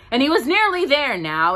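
rising excitement in the telling; building suspense. A narrator's reading. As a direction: lift it toward the climax. Narrator builds tension.

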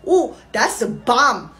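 slow, matter-of-fact broken English; a lightly put-on Korean mother's accent; Korean mom accent. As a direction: calmly explain it like nothing's wrong. Mother explains calmly.

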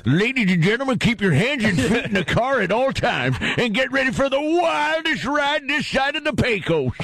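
high wild west barker; old west ride announcer. Pretend. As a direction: hype the crowd like a frontier barker. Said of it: "ghost town out west".

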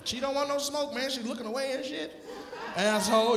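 ordinary delivery

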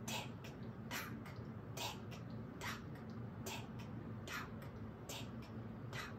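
breathy whispered tick-tock, clock-like. Pretend. Singer whispers the clock ticking to children.

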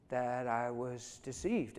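ordinary talk, nothing marked

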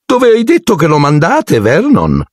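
very high, nosy aunt voice; high prying question; Aunt Marge. A narrator's reading. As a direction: ask pointedly, pitched very high. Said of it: "e disse".

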